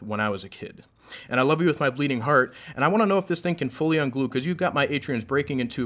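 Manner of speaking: rhythmic, building slam delivery; spoken word poem. Acted. Poet reciting a love poem to a crowd, spoken word. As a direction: perform a love poem on stage.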